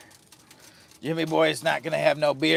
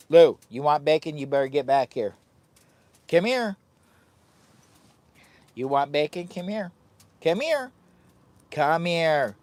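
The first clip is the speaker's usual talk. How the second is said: loud calling out to the dog; 4 dB louder than usual. Real, not acted.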